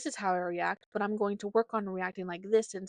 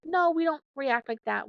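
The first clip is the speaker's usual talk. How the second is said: put-on firm, raised no to a child; firm correction; 5 semitones higher, 5 dB louder than usual. Shown as a demonstration. Parent correcting her kid, firm and raised. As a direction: correct the child firmly, a bit higher. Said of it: "gently kinda tell her".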